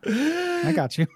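high, slow and drawn out. Acted. Mock high slow reply.

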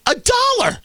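high, amazed cry of the price; incredulous. Real, not acted. Host marvelling at a dollar kiwi, amazed cry. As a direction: sell the disbelief on dollar.

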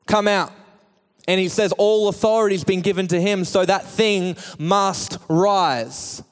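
high, driving full-force sermon voice; impassioned preaching. Real, not acted. Preacher calls dead hopes to come out, impassioned preaching. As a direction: pile up the phrases and call out the commands.

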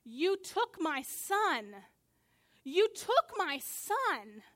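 grieving mother shouting at her son's killer; retold shouting. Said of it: "she just shouts over and over".

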